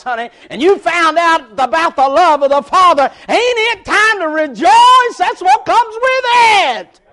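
loud, fast preaching; fiery preaching. Acted. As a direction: preach it out to the congregation. Preaching at full force.

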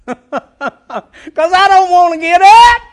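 high, loud comic panic; exaggerated pulpit holler; hammed-up preacher's wail. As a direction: cry out the mock dread to the congregation. Preaches own dilemma at full pitch.

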